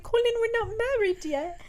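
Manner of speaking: high-pitched mock-demure protest; lightly hammed-up scandalised sweetheart; coy objection. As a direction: put on a high girlish objection. Voicing coy objection to kissing.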